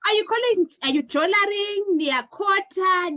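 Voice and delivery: usual speaking voice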